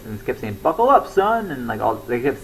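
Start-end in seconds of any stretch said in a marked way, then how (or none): 0.6-1.5 high, punchy drill sergeant call. Retold or imitated. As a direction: yell it at passing cars like a sergeant. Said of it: "they kept doing that voice".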